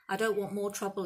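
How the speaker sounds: plain reading voice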